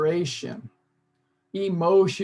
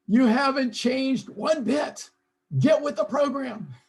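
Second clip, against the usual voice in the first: high, slow, exasperated scolding; mock scolding; 7 semitones higher, 3 dB louder, slower than usual. Voicing a chat reply to a frustrating partner, mock scolding. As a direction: scold like a fed-up partner, hammed up. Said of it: "What is it you say to yourself".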